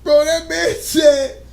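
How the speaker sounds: slow, high, laughing retelling; cracking up. Real, not acted. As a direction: fight through laughter to get it out.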